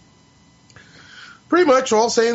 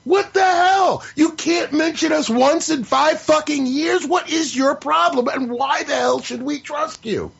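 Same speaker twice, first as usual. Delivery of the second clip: higher, rising into a furious shout at What the hell; building from mock gee to a yell; angry rant; 5 semitones higher than usual. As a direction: let it boil over into shouting. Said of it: "I've gone into from disappointment".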